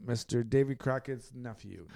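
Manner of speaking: big, loud show-host welcome, easing down toward the name; stretched-out, hyped host intro; podcast intro. Pretend. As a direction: announce the show like a ring announcer.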